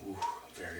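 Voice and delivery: ordinary delivery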